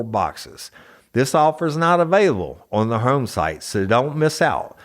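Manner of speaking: loud, pushy ad read. Acted. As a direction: sell it like a radio spot.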